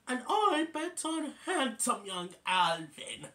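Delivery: high, doting lady's bet; Claudia. Retold or imitated. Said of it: "And then Claudia says".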